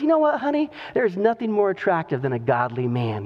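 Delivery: admiring wife dropping into a low, flirty register; flirting wife. Retold or imitated. Farmer's wife flatters her godly husband, flirting.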